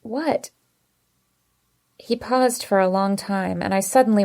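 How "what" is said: a small high squeak, soft and rising; tight-throated little squeak. A narrator's reading. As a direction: squeeze the word out high and thin. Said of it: "I managed to squeak out".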